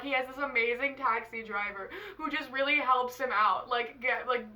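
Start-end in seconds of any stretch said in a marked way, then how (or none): none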